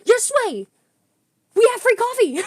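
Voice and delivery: high-pitched, thrilled insistence; elated, building exclamation; excited confirmation. Retold or imitated. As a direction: insist with giddy excitement, rising in volume. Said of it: "And I'm like".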